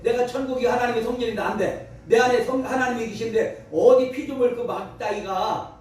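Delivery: ordinary delivery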